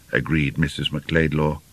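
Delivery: ordinary delivery, nothing marked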